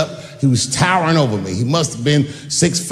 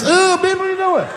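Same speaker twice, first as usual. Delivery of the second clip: much higher, mock-girly squeal; squealing woman. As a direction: squeal it like a giddy fan.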